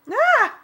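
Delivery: very high loud startled shriek; scream. A narrator's reading. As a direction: cry out in shock. Scream at crash.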